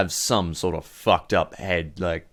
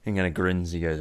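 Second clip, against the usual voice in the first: ordinary talk, nothing marked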